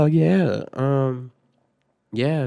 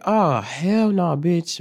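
high, loud, drawn-out refusal; appalled refusal; 5 semitones higher, 4 dB louder, much slower than usual. Retold or imitated. Student reacting to the classroom fire, appalled refusal. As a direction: stretch it out in mock outrage.

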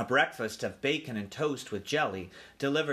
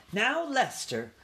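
high, drawn-out appeal; slow, placating aunt mouse; chiding aunt; 11 semitones higher, slower than usual. A narrator's reading. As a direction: gently check your husband. Aunt soothes her objecting husband.

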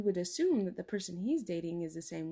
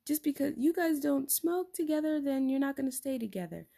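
mocking skeptic; 7 semitones higher than usual. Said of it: "some people might think like".

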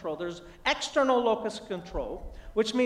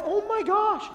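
high-pitched retold gasp of dismay; horrified dad, voice jumping up; mock panic; 11 semitones higher, slower than usual. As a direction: exclaim it in comic alarm. Dad learns toddler ate handful.

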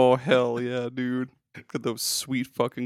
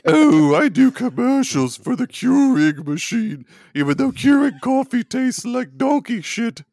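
bad British accent; 10 semitones higher, 8 dB louder than usual. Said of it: "How was my impression?".

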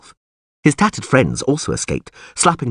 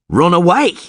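high, loud, incredulous shout; screaming; 9 semitones higher, 5 dB louder than usual. A narrator's reading. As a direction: scream the question back at her. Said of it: "Geralt screamed".

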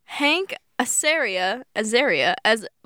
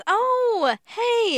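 high delighted oh hey; light up at the realization; surprised recognition; 11 semitones higher than usual. Real, not acted. Realizing who sang Anastasia, surprised delight.

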